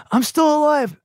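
high-pitched triumphant reveal, acted out; retold announcement, pitched well up; imitated movie character. Ted emerges from a side door to Bill, imitated high excitement. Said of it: "Ted emerges from a side door and is like".

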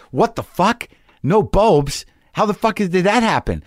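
stunned disbelief. Retold or imitated. Shocked at missing bulbs.

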